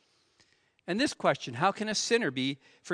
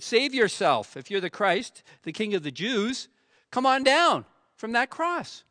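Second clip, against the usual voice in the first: taunting, rising to a loud jeer; mocking crowd; 6 semitones higher, 5 dB louder than usual. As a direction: jeer at him from below. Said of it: "the people still mocked him".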